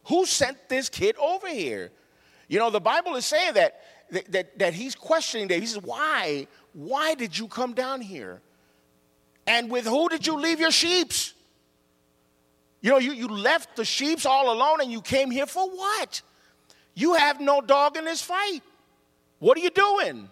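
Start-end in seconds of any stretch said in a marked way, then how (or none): 0.0-1.9 high-pitched indignant outburst as big brother; acted furious. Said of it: "he was angry and he was furious". 5.8-20.3 high-pitched indignant questioning, building louder; acted furious. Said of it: "he was angry and he was furious".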